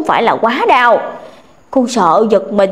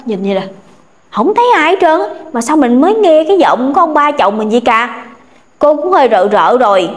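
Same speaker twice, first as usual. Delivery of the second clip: usual speaking voice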